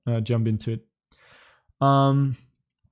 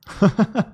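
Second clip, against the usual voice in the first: quick self-mocking laugh; a brief laugh at yourself; short laugh; 7 semitones higher than usual. Real, not acted. Laughs at own modesty.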